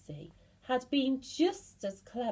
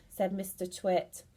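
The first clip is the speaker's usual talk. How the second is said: usual speaking voice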